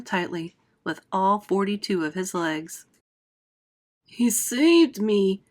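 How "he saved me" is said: slow, breathless gasp. Acted. Rescued centipede, dripping wet, gasping slowly. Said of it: "gasped the centipede".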